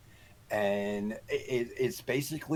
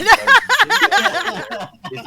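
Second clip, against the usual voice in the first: breaking into laughter mid-sentence, much higher and louder; laughing; 17 dB louder, much slower than usual. Real, not acted. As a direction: laugh through the words while telling it. Retelling wife yelling at him.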